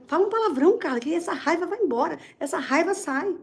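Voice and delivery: teasing coworkers. Retold or imitated. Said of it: "elas falavam assim".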